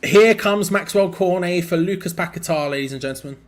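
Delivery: loud, mock stadium announcement; announcer voice. Streamer announcing the substitution to viewers, mock announcer. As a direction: announce the sub like a tannoy.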